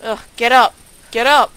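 loud, pushy yell; frustrated shout. Real, not acted. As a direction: shout at the character to move. Urging character to move.

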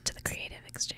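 put-on whispered show name in an American accent; breathy American-accent whisper; ASMR whisper, American accent. Mock ASMR intro of the show, whispered in an American accent. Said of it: "I had to do that in an American accent".

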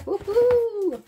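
high loud woohoo; excited cheer. Real, not acted. Vlogger cheering at the new album, excited cheer. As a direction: celebrate the reveal. Reveals Iron Savior album.

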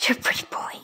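fast, hushed gasp-whisper. Real, not acted. To the cat, excited gasping whisper. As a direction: gasp then whisper it quickly.

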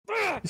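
short, high, loud mock scream; mock attack scream. Playing the YouTuber beating a fan on stage, mock scream. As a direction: let out a pretend berserk yell.

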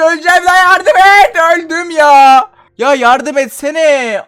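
high, loud, drawn-out panicked cries for help; stretched, shrill-pitched cry for help; panicked yelling. Real, not acted. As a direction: yell for help as if dying. Gamer panics, enemies too strong.

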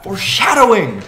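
loud, high playful shout; excited. Real, not acted. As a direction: call it out in jest. Joking exclamation.

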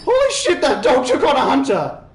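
very high, loud astonished laughter; amazed laughing shout. Real, not acted. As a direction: shriek with delighted disbelief.

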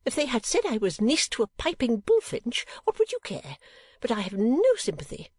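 high-pitched self-pitying complaint; high, peevish whimper. A narrator's reading. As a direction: whine it as an aggrieved mother. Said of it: "whimpered Missus Nickleby".

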